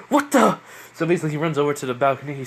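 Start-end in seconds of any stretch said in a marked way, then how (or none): 0.1-0.6 high, loud startled cry; suddenly alarmed at the noise outside. Acted. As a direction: jolt awake and blurt it out. Woken by commotion, startled.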